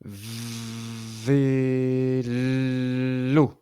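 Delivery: v-é-l-o held long, sound by sound; teacher segmenting phonemes, very slow; sounds stretched out. Shown as a demonstration. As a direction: draw out vélo so each sound is heard. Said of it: "Combien de sons entends-tu?".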